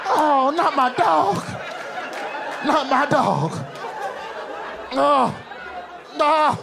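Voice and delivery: high, drawn-out wail of dismay; mock heartbreak. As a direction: mourn a fallen hero, hammed-up. Heartbroken fan refuses to believe it.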